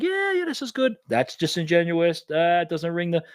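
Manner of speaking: high-pitched mock impression; Zuckerberg.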